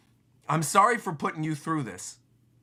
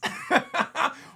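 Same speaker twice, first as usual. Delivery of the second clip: giggle; 13 semitones higher than usual. Real, not acted. Laugh between read lines.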